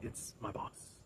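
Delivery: whispered, low and breathy warning; ominous whisper. Retold or imitated. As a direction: drop to a secret whisper on the threat. Gina's quoted threat about boss.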